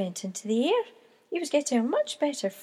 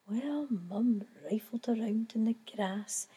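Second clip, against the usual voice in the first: whispered storytelling, almost breathless; hushed narration; 4 semitones lower, 6 dB quieter than usual. A narrator's reading. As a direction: draw them in with a whisper.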